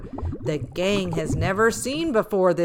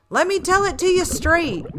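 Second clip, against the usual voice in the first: blunt octopus; 10 semitones higher, 4 dB louder, faster than usual. Acted. Octopus voiced scolding.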